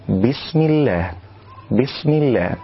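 بسم with a smooth still s; steady sin. Shown as a demonstration. Teacher gives the correct reading. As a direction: keep the sin of بسم calm and settled. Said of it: "وإنما يقول".

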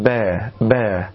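با said between a and i, tilted toward e; leaning the vowel of با off the kasra; tilted ba demo. Shown as a demonstration. Teacher shows the wrong ba to students. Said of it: "ولا أن يقول با".

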